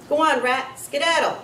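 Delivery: bully pushing the hamster away; bully shooing. A narrator's reading. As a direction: shoo it off with a sneer.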